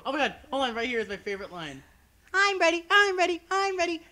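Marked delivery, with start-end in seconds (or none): 2.3-4.0 much higher, louder eager chant; repeated eager cartoon chant; SpongeBob excitement. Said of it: "from SpongeBob moment".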